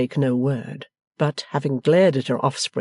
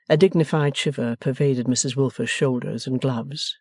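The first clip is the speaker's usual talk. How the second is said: plain reading voice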